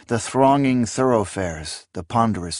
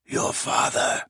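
hoarse unvoiced old man's rasp; 3 dB quieter than usual. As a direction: rasp it like a dying old man. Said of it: "the old man in Gim had rasped".

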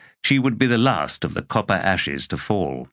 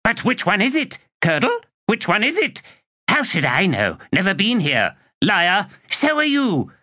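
much higher squabbling undead voices; two skeletons bickering, much higher; 13 semitones higher than usual. A narrator's reading. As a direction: squabble like petty little skeletons. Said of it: "skeletons bickering".

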